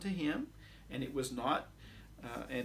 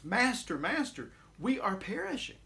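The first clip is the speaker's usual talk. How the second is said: a mild, higher cry of alarm; urgent fearful cry; 7 semitones higher than usual. Acted. Frightened disciples cry to Jesus in the storm, urgent. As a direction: call out to the master, a touch of panic. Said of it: "capture their sense of urgency and perhaps their fear".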